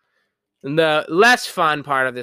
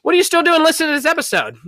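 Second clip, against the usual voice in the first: excited ad hype; 11 semitones higher, 5 dB louder, faster than usual. Acted. Hyped fake ad push.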